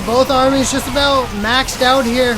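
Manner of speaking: loud, rising caster hype; excited play-by-play. Real, not acted. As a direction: call the big fight. Big engagement cast.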